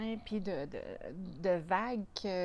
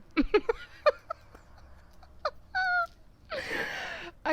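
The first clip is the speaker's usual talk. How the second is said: long squealing laugh, much higher; 5 dB louder than usual. Real, not acted. Vlogger watched by her dog, long high laughter. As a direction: laugh helplessly at being watched.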